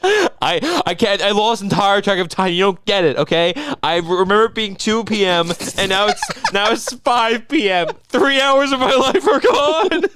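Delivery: loud, high mock anguish breaking into laughter; mocking co-host impression. Mocking co-host who lost three hours, loud high wail. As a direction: overdramatic wail over lost time. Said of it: "I'm making fun of you".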